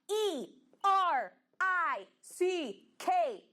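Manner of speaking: teacher spelling out a name. Shown as a demonstration. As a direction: spell Eric out letter by letter. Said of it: "Vamos lá".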